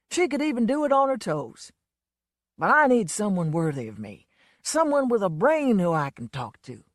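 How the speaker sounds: a lightly voiced boozy Southern showman; a hint of a drunk country star, fed up; lightly played drunk blowhard; Lonesome's voice. A narrator's reading.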